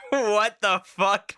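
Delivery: ordinary talk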